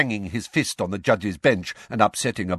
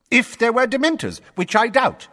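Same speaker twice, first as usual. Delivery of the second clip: higher, louder official lecturing the court; pompous blustering minister; 6 dB louder than usual. A narrator's reading. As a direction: lay down the rules like an irritated official. Fudge scoffing.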